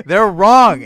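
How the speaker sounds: loud, high, insistent cry; emphatic shout. Real, not acted. Film lover insisting theater doubters are mistaken, shouting emphatically. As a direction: hammer the rebuttal home at full voice.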